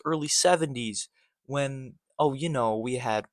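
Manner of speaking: ordinary delivery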